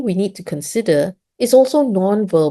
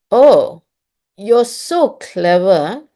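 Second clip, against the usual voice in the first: drawn-out, sarcastic "so clever" with a rise on "so"; 3 semitones higher, 3 dB louder, slower than usual. Shown as a demonstration. Teacher showing parents how sarcasm sounds. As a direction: stretch "Oh... you're so clever" mockingly, not meaning it. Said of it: "the person is being sarcastic".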